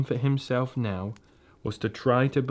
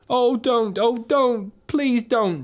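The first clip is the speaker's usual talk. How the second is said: very high, louder desperate plea; shouting; 12 semitones higher, 4 dB louder, slower than usual. Acted. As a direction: beg her desperately to stop. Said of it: "shouted Edmund".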